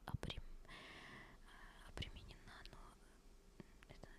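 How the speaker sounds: ordinary talk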